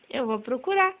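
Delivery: ordinary delivery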